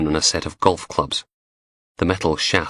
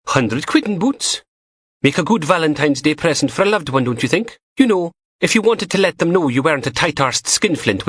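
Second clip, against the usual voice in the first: higher teasing female voice; young woman voice; 8 semitones higher than usual. A narrator's reading. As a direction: play the cheeky lab tech, higher. Narrator voices Samantha.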